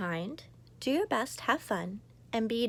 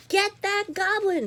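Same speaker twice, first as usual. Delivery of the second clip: higher, louder angry crowd; shout; 12 semitones higher, 6 dB louder than usual. Acted. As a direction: everyone chasing the goblin. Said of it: "shouted everyone".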